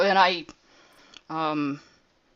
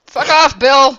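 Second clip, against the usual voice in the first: loud, raised-pitch shout; angry yell; 9 semitones higher, 15 dB louder, slower than usual. Acted. Player telling the skeleton Bill off, loud angry shout. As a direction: yell it as a sharp rebuke.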